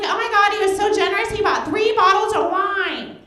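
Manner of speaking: high, loud mock gushing; gushing friend. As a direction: gush like a starstruck friend. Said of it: "She's like".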